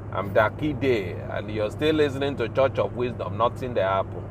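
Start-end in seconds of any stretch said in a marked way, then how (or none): none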